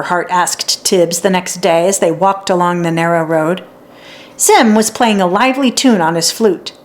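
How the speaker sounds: ordinary delivery, nothing marked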